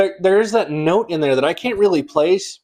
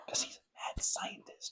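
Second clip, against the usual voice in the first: very soft, voiceless whisper; whispered aside; 21 dB quieter than usual. Real, not acted. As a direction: slip it in as a hushed aside. Hushed aside about the bartender.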